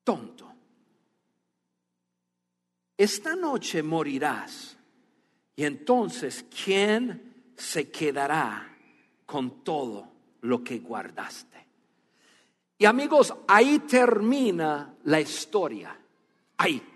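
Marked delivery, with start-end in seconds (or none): 0.0-11.7 God's stern verdict. Acted. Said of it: "Pero Dios le dijo".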